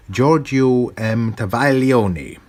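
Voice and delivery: Italian accent. Shown as a demonstration. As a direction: roll out Giorgio M. Travaglioni Italian-style. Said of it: "an attempt at an Italian accent".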